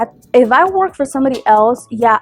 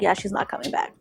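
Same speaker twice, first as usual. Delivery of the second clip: very soft, low aside to oneself; muttered aside; 3 semitones lower, 10 dB quieter than usual. Retold or imitated. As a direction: drop the volume as if thinking aloud. Muttered inner thought about client.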